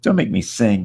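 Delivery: high, theatrical drama queen plea. Retold or imitated. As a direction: ham it up like an old theater diva. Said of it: "the old drama queen, the theater lady".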